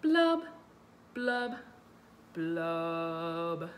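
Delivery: descending blubs. Shown as a demonstration. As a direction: slide each blub down. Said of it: "going down, down, down until it's very low".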